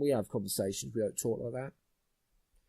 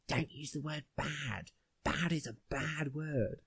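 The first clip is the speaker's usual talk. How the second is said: lightly voiced teacher, drawn-out words, softer; slow, measured, softly pedantic teacher; English teacher; 2 semitones higher, 5 dB quieter, slower than usual. English teacher laying down a rule about words, slowly pedantic. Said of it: "it's like in English, and he says".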